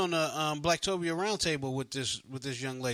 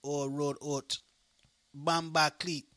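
put-on Jamaican accent. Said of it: "that's the extent of my Jamaican accent".

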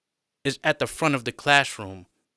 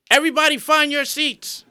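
calling out; 6 dB louder than usual. Acted. Teacher calls class to order.

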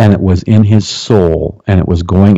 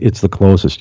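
ordinary delivery

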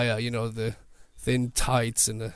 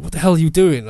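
louder confronting demand; angry challenge; 3 semitones higher, 7 dB louder, faster than usual. Retold or imitated. Imitating the army man confronting the photographer, louder. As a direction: bark the question.

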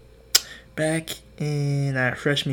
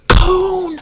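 high, loud drawn-out shout; reenacted gamer's holler; excited yell; 13 dB louder than usual. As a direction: belt it out like a match call. Reliving shot in video game.

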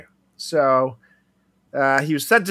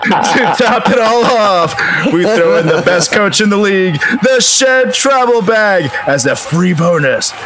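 punchy sponsor read, louder and upbeat; 8 semitones higher, 9 dB louder than usual. Acted. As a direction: sell it like a football pregame hype. Ad read as football lineup.

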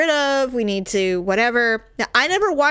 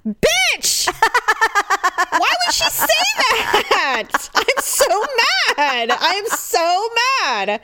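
much higher, half-laughing fury; mock outrage; 10 semitones higher than usual. Real, not acted. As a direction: squeal with comic indignation at her sister. Outraged at sister's text.

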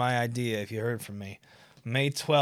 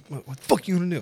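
mock tough challenge; 6 semitones higher, much faster than usual. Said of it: "And I said that".

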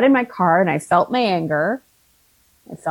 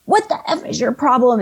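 a higher, sharp retold curse; mock angry swearing; 5 semitones higher than usual. Woman in the parking lot swearing at the speaker, mock angry. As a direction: throw the line back at her like the stranger did. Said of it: "starts swearing at me".

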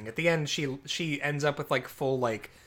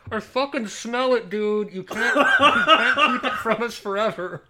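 higher, louder mock gangster through laughter; a hammed-up thug calling from the trunk; laughing mobster voice; 7 semitones higher, 7 dB louder than usual. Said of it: "just from the trunk".

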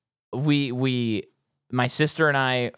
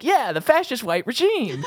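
much higher parroting voice; imitating Joe Pesci; 11 semitones higher than usual. Retelling the movie, Pesci parroting Murtaugh, high mock voice. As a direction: echo it back like a dim sidekick. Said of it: "Joe Pesci is kind of loosely repeating it".

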